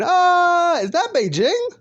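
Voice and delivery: high, loud comic wail; scream, break off to admire the view, scream on. Acted. All For One flung around the world, hammed-up screaming. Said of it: "All For One screaming".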